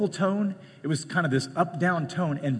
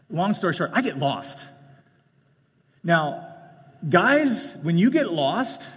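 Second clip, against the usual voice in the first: ordinary talk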